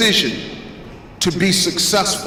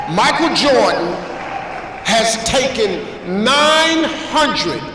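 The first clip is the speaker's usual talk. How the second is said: motivational preaching; 5 semitones higher than usual. Real, not acted. Motivational speaker to audience on Jordan's misses, preaching emphatically. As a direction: drive each phrase home like a preacher, with weighty pauses.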